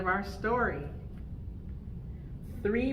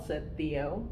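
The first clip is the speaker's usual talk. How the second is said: ordinary delivery, nothing marked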